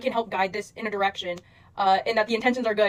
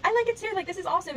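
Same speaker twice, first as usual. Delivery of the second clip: gushing commenter; 7 semitones higher than usual. Retold or imitated. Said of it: "being like".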